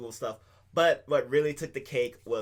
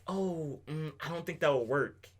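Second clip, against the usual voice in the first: humanlike hesitation. Retold or imitated. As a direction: lightly act out a natural thinking pause. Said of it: "She had inflections in her voice".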